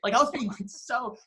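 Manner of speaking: normal talking voice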